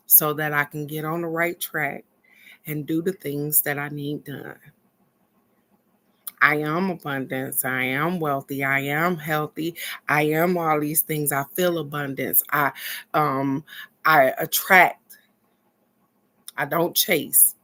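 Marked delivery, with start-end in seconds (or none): 6.4-17.6 affirmations. Acted.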